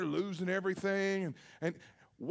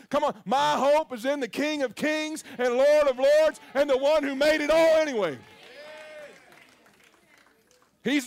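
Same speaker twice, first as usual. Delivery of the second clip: rousing, rising sermon cadence; full-force preaching; 3 semitones higher than usual. Acted. Pastor rallying the congregation, preaching at full force.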